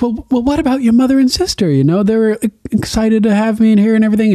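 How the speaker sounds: high, quick and fretful; hurried, anxious high voice; worried Gilly. Retold or imitated. Gilly fretting to Sam about his family, high and fast. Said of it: "Gilly's like".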